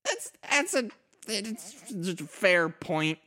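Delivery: high, slow, meek agreement; timid high voice. Acted. Spike replies in character.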